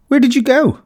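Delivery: put-on high, urgent girl's plea; high child's anxious cry. A narrator's reading. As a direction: call out to her friend, anxious and eager. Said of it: "cried Hannah".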